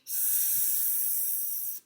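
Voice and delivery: "S-" as a long hissed s alone, no vowel; hissed s sound demo; first sound. Shown as a demonstration. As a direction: isolate the s sound of snail. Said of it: "What is it?".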